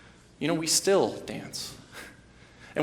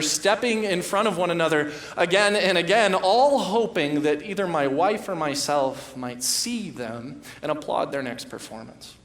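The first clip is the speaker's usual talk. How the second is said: ordinary talk, nothing marked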